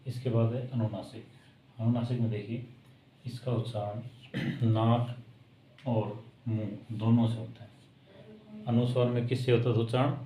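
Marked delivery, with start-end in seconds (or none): 3.3-8.6 slowed right down, each word laid out for pupils; slow emphatic explaining. Shown as a demonstration. Teacher slowly stressing the rule to young pupils. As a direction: spell it out slowly so the class catches it.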